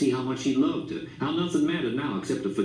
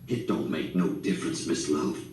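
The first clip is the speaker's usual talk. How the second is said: hoarse; 7 semitones lower than usual. A narrator's reading. Grandpa reassuring his wife after her confession, hoarse and low. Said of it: "Grandpa's voice was hoarse".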